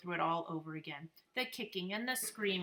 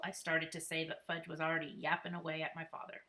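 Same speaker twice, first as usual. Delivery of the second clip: ordinary delivery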